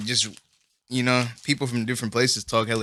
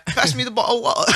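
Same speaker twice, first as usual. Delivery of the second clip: higher-pitched British imitation; British accent; 7 semitones higher than usual. Shown as a demonstration. Friend's turn in accent contest, British accent. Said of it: "British accent".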